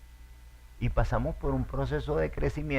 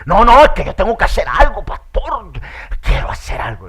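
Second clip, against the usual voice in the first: much louder, higher insistent plea; impatient shout; 9 semitones higher, 15 dB louder than usual. Retold or imitated. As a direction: insist loudly on doing something. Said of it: "un hermano me dijo". (hermano insistente gritando)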